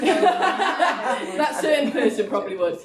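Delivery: laughing through the words, slow. Real, not acted. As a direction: laugh it out, amused at the jab. Laughing about a certain person.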